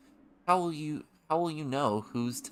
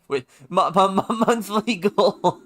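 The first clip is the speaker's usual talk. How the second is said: laughing; 10 semitones higher, 12 dB louder than usual. Real, not acted. Reads goal through laughter.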